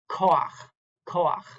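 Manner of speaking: "koach" with an "a" before the final ch; furtive patach. Shown as a demonstration. Teacher giving another example word, "koach" said "ko-akh". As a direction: say "koach" as "ko-akh", vowel first. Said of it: "Or here's another, uh, example".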